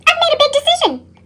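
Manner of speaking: ordinary delivery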